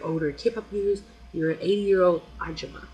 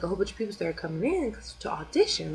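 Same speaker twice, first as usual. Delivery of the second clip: normal talking voice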